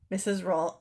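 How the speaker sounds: ordinary delivery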